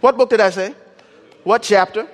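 rising, testing question to the crowd; quizzing tone. Shown as a demonstration. Preacher to congregation, testing whether they listen. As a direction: quiz them like a teacher checking. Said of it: "I'm making sure you're listening".

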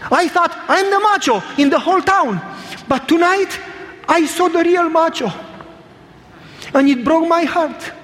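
high-pitched imitated weeping voice; crying. Big man confessing to the preacher, mock tearful crying.

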